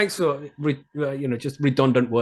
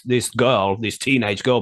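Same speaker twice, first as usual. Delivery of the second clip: normal talking voice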